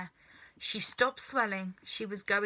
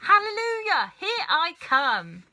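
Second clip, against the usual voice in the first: grandma crowing as she grows; triumphant shout; 8 semitones higher, 8 dB louder than usual. A narrator's reading. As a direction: crow it to the rafters. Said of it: "she shouted".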